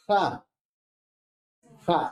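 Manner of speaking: "Ja" with rasping friction on the J; fricative demo. Shown as a demonstration. Teacher encouraging students to pronounce, audible friction. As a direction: scrape the air through "ja", separately each time. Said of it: "Ja, hay una fricción".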